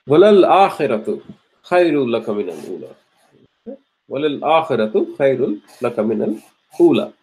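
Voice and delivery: fast melodic Quranic recitation. Acted. Teacher recites the verse to the class in chant. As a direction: chant the verse in tajweed style.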